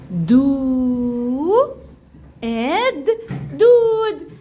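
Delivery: "دو" drawn long, "إد" apart, then "دود" whole; syllable sounding-out. Shown as a demonstration. Teacher writing a new word for pupils, sounding out "دو إد دود" syllable by syllable. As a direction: stretch "دو", pause, add "إد", blend to "دود".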